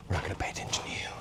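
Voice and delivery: quick secretive whisper. Pretend. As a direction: hiss it fast as a conspiratorial aside. Whispering aside to the cannon.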